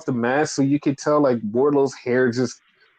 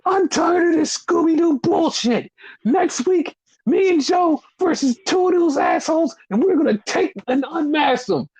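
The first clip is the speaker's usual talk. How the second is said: much higher, mocking whiny voice; hammed-up bratty wrestler, pitched way up; imitating Max; 14 semitones higher than usual. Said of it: "Max goes".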